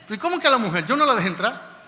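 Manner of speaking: loud, high protesting disbelief; incredulous. Retold or imitated. Guard who barred the wife hears of her visa, incredulous. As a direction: re-enact an indignant double-take.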